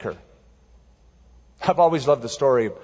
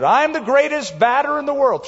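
much higher, mock shouted boast; kid's shout; 12 semitones higher, 3 dB louder than usual. Boy in the backyard shouting his boast before the second swing. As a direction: call it out like a boy hyping himself up.

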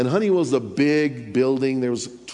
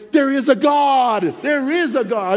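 loud, high exclaiming; amazed shout; 10 semitones higher, 6 dB louder than usual. Retold or imitated. Preacher marvels at coworker's conversion, loud amazed cry. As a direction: proclaim it in wonder. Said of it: "thinking in my mind".

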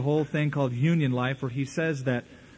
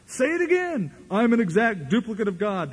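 much higher, rising to a rally cry; imitating a revved-up preacher; 7 semitones higher than usual. Preacher urging the crowd to say it again, revved up. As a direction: pump up the crowd. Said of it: "getting into it louder and bolder".

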